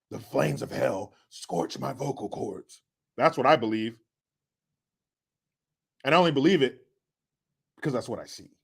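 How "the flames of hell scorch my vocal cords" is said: slow, softer hoarse rasp; lightly raspy devil's nephew; raspy Newsom impression. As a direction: croak it like scorched vocal cords. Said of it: "talks like this, raspy".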